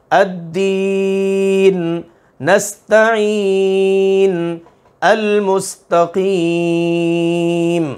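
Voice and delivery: slow, drawn-out tajweed chanting; Quranic recitation. Shown as a demonstration. Teacher reciting Quran words to students, slow and melodic. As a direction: chant each word with long held vowels.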